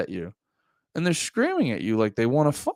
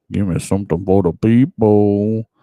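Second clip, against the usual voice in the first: calling out louder to the screen; a louder plea to the algorithm; 4 semitones lower, 7 dB louder than usual. Acted. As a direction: call it out, louder this time. Calling out to YouTube.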